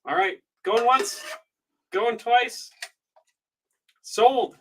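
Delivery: high rising auction call, then sold; auctioneer call. Real, not acted. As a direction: close the sale like an auctioneer. Auctioneer closing the sale.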